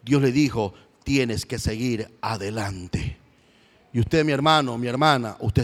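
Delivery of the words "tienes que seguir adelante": slow, softer, lower; tender word from God. Acted. God speaking to Elijah, soft and slow. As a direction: gently urge him onward.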